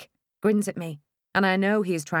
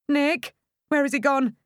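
anxious calling; 8 semitones higher, 4 dB louder than usual. A narrator's reading. Searching for husband in panic.

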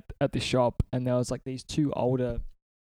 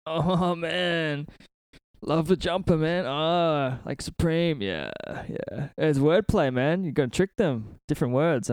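higher, drawn-out stoner voice; stoner kid; 4 semitones higher, slower than usual. Retold or imitated. Stoner skate shop clerk admiring the band jumper, imitating stoner kid. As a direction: imitate an overeager stoner with stretched-out words. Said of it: "this fucking stoner kid who was working the desk was like".

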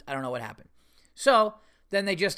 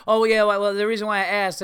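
louder, higher flustered imitation; mock backtracking; 5 semitones higher, 7 dB louder than usual. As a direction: mimic her stumbling to take it back. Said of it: "she kinda backtracked a little bit".

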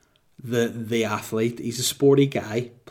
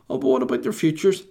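mock whiny complainer; 5 semitones higher, 3 dB louder than usual. Mocking complaining kids' parents.

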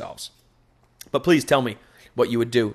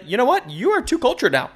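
high, mocking future boss telling you off; 9 semitones higher, 4 dB louder than usual. Imagined boss scolding the returning worker, high and mocking. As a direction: squeak it out like a snooty manager. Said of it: "they're probably gonna be like".

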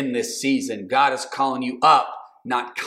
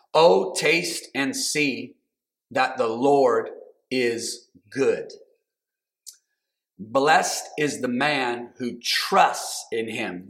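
scripture reading; 2 semitones lower, slower than usual. Real, not acted.

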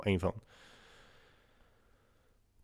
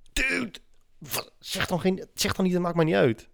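exasperated; 9 semitones higher than usual. Real, not acted. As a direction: vent in disbelief. Exasperated rant about Dutch politeness.

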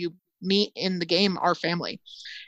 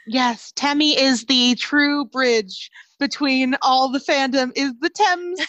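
higher, louder, slow goofy squeal; high silly voice; 7 semitones higher, 5 dB louder than usual. Acted. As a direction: ham it up like a cartoon. Keeps character voice, joking.

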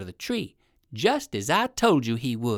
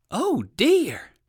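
high, louder fretful oh dear; alarmed exclaim; 10 semitones higher, 4 dB louder than usual. Acted. Rabbit stuck up a tree, high worried exclaim. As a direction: gasp it out, worried old rabbit. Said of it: "exclaimed Uncle Wiggily".

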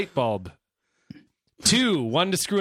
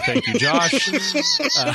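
squeaky high laughter; laughing; much slower than usual. Real, not acted. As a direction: laugh through the words. Laughing through thanks.

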